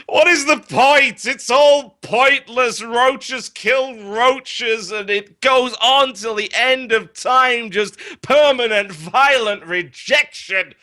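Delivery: high, loud, hammed-up existential lament; melodramatic despair. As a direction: overwrought tragic monologue. Overwrought despair monologue.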